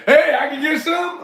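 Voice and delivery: high, loud mock voice of someone begging a bite; eager moocher. Gym-goers asking for his grapes, eager mock moocher. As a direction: call out a high, pushy imitation of a food beggar.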